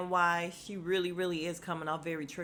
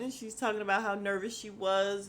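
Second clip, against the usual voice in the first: ordinary talk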